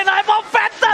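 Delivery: high, dramatic announcer callout; fighting game announcer. Retold or imitated. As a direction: belt it like the game's announcer. Caster mimics in-game announcer callout.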